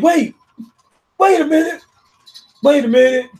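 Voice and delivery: high, loud panicked wait a minute; panicked pleading. Retold or imitated. The doomed villain begging for time, high panic. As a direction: plead for a moment, voice jumping up. Reacting to anime, voicing panicked character.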